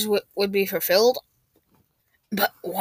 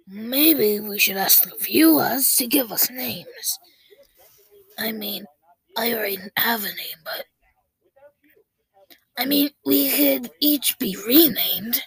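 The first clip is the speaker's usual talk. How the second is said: scratchy whisper voice. Acted.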